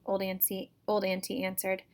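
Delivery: ordinary delivery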